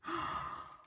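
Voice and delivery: sharp gasp at a lovely surprise; astonished gasp. Real, not acted. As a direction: gasp in amazed delight. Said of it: "This is gonna be a live reaction".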